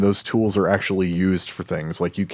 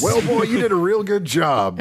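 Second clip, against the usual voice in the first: high cartoon cowboy accent, pitched way up; Yosemite Sam accent; 12 semitones higher than usual. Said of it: "Yosemite Sam American accent".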